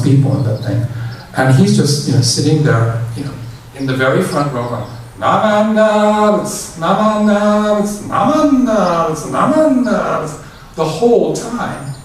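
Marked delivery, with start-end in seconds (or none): 5.2-10.2 higher, slow, drawn-out chant; high, stretched mock-priest chant; imitating the famous priest. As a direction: copy the old priest chanting from the front row. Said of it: "you hear him in this huge voice".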